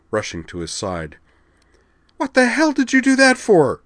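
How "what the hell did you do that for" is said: loud, high screaming demand; panicked demand. A narrator's reading. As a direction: yell at him in shock and panic. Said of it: "she demanded".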